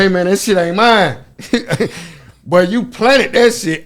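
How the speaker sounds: loud, high protest that it isn't his; heated denial. Retold or imitated. Protesting to the cop who planted the drugs, loud and indignant. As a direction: plead loudly, then slow and drawn out.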